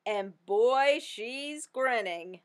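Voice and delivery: ordinary delivery, nothing marked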